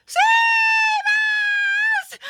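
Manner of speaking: very high, loud drawn-out shriek; desperate cry. A narrator's reading. Another sister begging to be saved, shrieking. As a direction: shriek for rescue. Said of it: "cried another".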